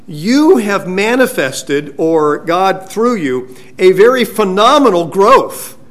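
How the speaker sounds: slow, weighty, each phrase pressed hard; emphatic quote. Retold or imitated. Preacher quoting Paul to the church, slow and emphatic. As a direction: lean into every word as Paul would. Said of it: "he puts this in a very emphatic way".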